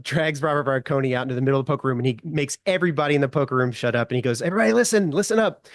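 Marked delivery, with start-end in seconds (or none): 4.4-5.7 higher-pitched, commanding celebrity impression; Donald Trump. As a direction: do a hammy announcer demanding attention. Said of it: "This is my Donald Trump impression".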